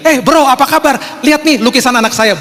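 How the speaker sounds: high-pitched hearty greeting, stretched out; eager pitch to friends. Retold or imitated. Proud father showing friends his child's painting, eager and high. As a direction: hail a buddy and show it off.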